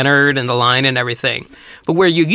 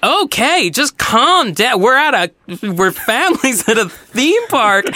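higher-pitched, lightly voiced fed-up mom; mock exasperation, pitched up; imitated exasperated mom; 7 semitones higher, slower than usual. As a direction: plead for calm like an affronted mom. Mom pushes back at yelling stranger.

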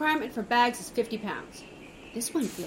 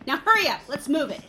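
bossy; 4 semitones higher, 7 dB louder than usual. Acted. Producer hurrying the contestant.

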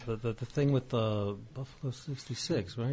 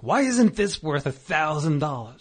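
indignant; 5 semitones higher, 7 dB louder than usual. Retold or imitated. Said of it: "I'm like".

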